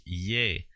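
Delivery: "Ye" drawn out slowly as French "yé"; slow Quebecois-style "Ye", like "yé". Shown as a demonstration. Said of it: "in Quebecois, we would say".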